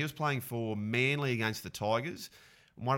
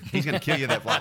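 laughing through the punchline, higher and louder; 5 semitones higher, 6 dB louder than usual. Real, not acted. Retelling the referee's warning to a mate, laughing.

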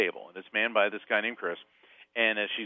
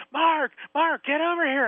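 high, urgent imitated summons, a bit louder; emphatic calling out; 5 dB louder than usual. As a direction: call someone over insistently in a raised, high-pitched voice. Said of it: "like, really emphatic".

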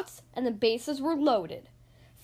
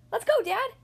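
put-on eager kid voice; 9 semitones higher, 3 dB louder than usual. Eager Froggy voice.

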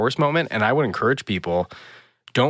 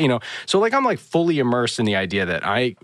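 usual speaking voice

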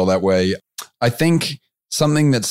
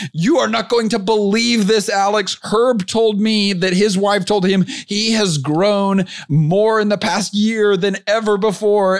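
much higher, gushing and amazed; excited member quote; 10 semitones higher, slower than usual. Retold or imitated. Retelling a member's voice memo to the host, high excited gush. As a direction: gush like a thrilled young entrepreneur. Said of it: "I wish I could do his accent, but I can't do his accent".

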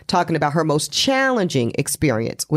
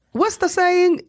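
leaps up high, playful; high rhetorical question; 14 semitones higher, slower than usual. Real, not acted.

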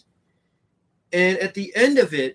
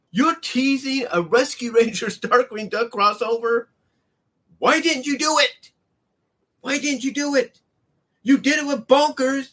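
higher, louder, pleading why; exasperated disbelief; 8 semitones higher, 4 dB louder than usual. Real, not acted. Fan venting at Disney over the missing crossover, exasperated and higher. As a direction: throw up your hands at the studio.

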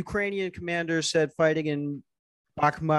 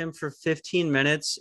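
ordinary delivery

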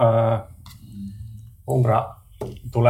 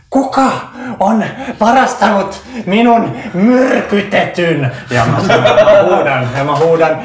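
higher, louder furious yell at the camp; loud shout; 9 semitones higher, 6 dB louder, slower than usual. Acted. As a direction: bellow it out, stretched and half laughing. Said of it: "huudan todella kuuluvalla äänellä". (kovaa huutaen leirille)

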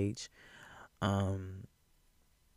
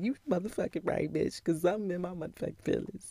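high, hyped shout back; excited high yell; 8 semitones higher than usual. Retold or imitated. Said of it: "And I was like".

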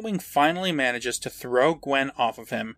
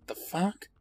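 quiet, higher, questioning mutter; baffled mom; 4 semitones higher, 9 dB quieter than usual. Acted. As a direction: ask it softly, in mild bewilderment. Mom baffled by the kids' demand.

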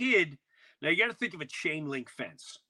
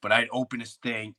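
ordinary delivery

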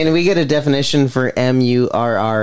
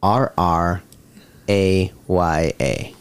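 spelling it out; 5 semitones lower, much slower than usual. Pretend. Spelling bee contestant spelling slowly.

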